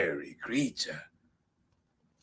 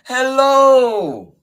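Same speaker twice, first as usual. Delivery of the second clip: high, loud mouse hello; calling out greeting; 17 dB louder, much slower than usual. Acted. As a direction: sing out a big drawn-out hello. Mouse calls a greeting to Fox.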